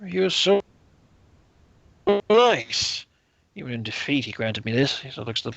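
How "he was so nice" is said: put-on maudlin drunk noble with swooping emphasis; drunken lord. Drunken lord praises his rival to the envoy, tipsy and rambling.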